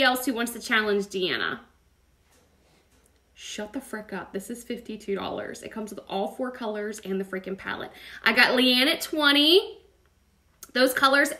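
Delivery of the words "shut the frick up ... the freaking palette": muttered aside. Real, not acted. Mutters aside about the price.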